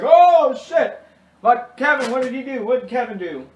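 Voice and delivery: loud, high mock-shocked yelling; shocked crowd outcry. Everyone reacting to Kevin's mess, shocked outcry. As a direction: exclaim it like a whole alarmed family.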